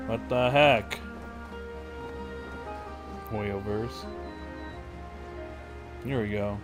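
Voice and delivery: usual speaking voice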